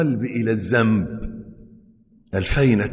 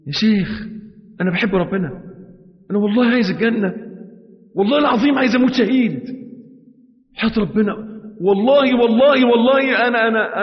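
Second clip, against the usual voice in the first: much higher and louder anxious swearing; desperate pleading; 10 semitones higher, 3 dB louder than usual. Acted. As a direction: plead for help in a rush. Pleading to the sheikh.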